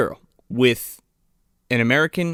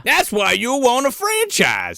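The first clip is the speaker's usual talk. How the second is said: high, drawn-out salesman bellow; hammed-up movie huckster, stretching the pitch; Big Ben; 9 semitones higher than usual. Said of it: "He's like fucking Big Ben".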